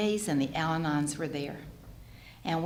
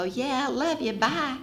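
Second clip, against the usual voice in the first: breezy brush-off; 7 semitones higher, slower than usual. Retold or imitated.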